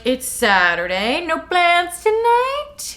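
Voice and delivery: high, slow dad voice; imitating Charlie. Imitating Charlie asking Bella, slow and high. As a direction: drag it out like a nosy dad. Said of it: "Charlie's like".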